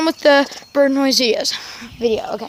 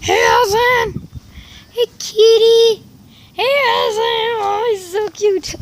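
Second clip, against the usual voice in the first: high, slow, drawn-out call; calling the cat; 8 semitones higher, slower than usual. Real, not acted. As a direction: stretch it out calling across the yard.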